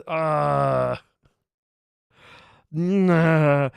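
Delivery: loud, drawn-out exasperated groan; frustrated groan. Real, not acted. Streamer kicking himself over a missed hint, frustrated groan. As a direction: groan loudly in self-reproach.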